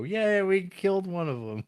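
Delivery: high, loud mocking cheer. People on the coast cheering a hit, high loud mock cheer. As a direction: cheer it like a gleeful goon. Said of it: "who go".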